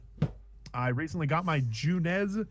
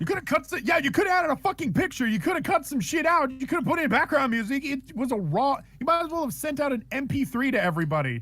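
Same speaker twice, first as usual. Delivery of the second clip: higher, faster exasperated ranting; heated rant; 9 semitones higher than usual. Real, not acted. Ranting at the co-host about the unedited episode, exasperated. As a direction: pile on the complaints.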